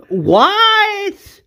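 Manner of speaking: high, drawn-out mock outraged cry; incredulous high wail; exaggerated disbelief. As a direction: stretch it upward in disbelief. Acted disbelief at character's death.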